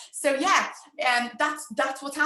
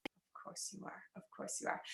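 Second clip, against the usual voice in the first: whispered aside; 3 semitones lower, 19 dB quieter than usual. Acted. Answers own question.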